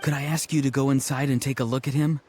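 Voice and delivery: another character. Acted. Different deep voice speaks.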